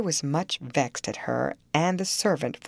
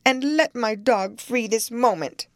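higher, louder spoiled command; imperious exclamation; 7 semitones higher, 4 dB louder than usual. A narrator's reading. Cathy ordering the servant woman, loud and imperious. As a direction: bark it like a spoiled young mistress. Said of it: "she exclaimed".

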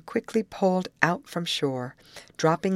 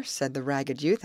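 plain reading voice

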